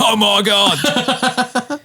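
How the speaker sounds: high, very slow mock gasp of surprise. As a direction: ham up the shock. Mock reaction to coming out.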